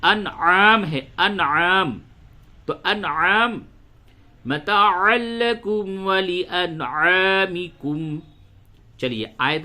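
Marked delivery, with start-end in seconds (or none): 0.0-2.0 انعام with a pressed ain, said louder; clear ain. Shown as a demonstration. Teacher loudly models the ain in انعام for students. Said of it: "تو اس وجہ سے یہاں پہ". 4.4-8.2 slow chanted tajweed recitation; Quran recitation. Acted. Teacher recites the verse slowly to students.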